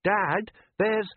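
put-on high young boy's voice, uneasy; a worried son asking his dad; boy Les. A narrator's reading. Son Les doubting his father at sea, high uneasy boy voice.

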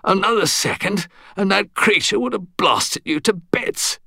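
high-pitched, stressed British warning; stern English gentleman. A narrator's reading. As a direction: warn him like a stern old soldier. Stuart warning the narrator.

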